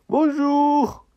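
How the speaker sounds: high, bright-eyed puppet hello; high puppet greeting. Acted. Puppet says hello to the elephant in a high voice. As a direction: chirp it like a tiny puppet.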